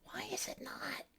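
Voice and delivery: whispered frustrated question; very soft, voiceless plea; exasperated whisper. Real, not acted. Frustrated that game sound fails.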